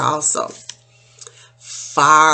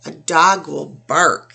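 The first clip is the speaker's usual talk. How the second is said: normal talking voice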